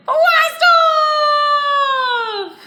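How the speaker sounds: high, loud blast-off cry; excited shout. Acted. Teacher shouts blast off at the end of the countdown. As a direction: launch it with everything you have.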